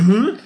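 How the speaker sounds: high startled huh; a loud goofy squeak of surprise; silly high huh. Retold or imitated. Predator turns, noise.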